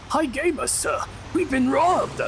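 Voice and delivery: high-pitched, put-on character voice; squeaky little messenger's alarm; Winslow's alarmed report. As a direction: pipe up high to report bad news. Voicing Winslow in a story.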